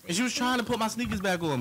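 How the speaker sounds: normal talking voice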